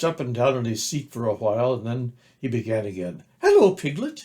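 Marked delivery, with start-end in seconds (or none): 3.4-4.2 high, louder squeak; squeaky. A narrator's reading. Excited little Roo greeting Pooh, high squeak. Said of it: "he squeaked".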